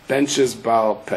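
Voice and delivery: usual speaking voice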